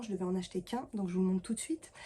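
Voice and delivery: ordinary talk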